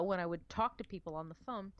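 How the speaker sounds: normal talking voice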